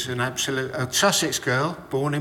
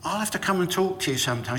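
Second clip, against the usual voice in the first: hurried brush-off; 6 semitones higher, much faster than usual. Retold or imitated. Brushing off the caller.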